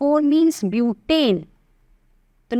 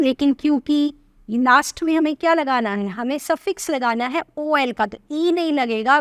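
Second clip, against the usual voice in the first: usual speaking voice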